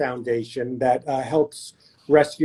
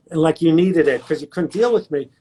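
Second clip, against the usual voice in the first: ordinary talk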